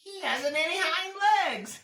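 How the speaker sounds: high, loud scornful bunny voice; mocking rabbit. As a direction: jeer like a cheeky rabbit. Strange rabbit mocks.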